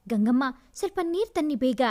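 fast urgent order; man hurries the maid for water, fast; hurried. Acted. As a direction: rush the words out. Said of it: "ಅವಸರಿಸಿದ".

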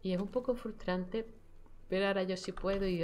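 normal talking voice

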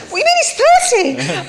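high, slow laughing tease. Real, not acted. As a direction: tease while laughing hard.

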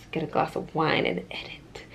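soft whispered aside; whispering. Real, not acted. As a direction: whisper it, almost unvoiced. Late night quiet aside.

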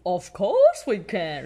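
loud, slow jolly dad pig; Daddy Pig voice. A narrator's reading. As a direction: reassure like a cheerful father. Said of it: "replies Daddy Pig".

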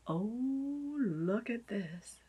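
slow awed whisper; hushed, slow amazement. Real, not acted. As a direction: whisper it slowly in wonder. Whispered awe.

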